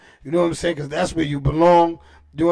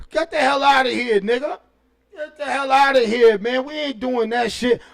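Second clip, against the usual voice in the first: mock telling off; 6 semitones higher than usual. Retold telling off Bev.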